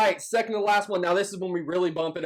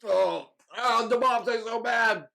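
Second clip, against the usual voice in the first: mouth burning from spicy food; 6 semitones higher, slower than usual. Real, not acted. After eating hottest sauce.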